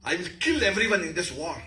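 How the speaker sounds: high, loud war cry of anger; furious rage. Shown as a demonstration. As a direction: threaten them all, building to a shout. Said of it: "Red with anger".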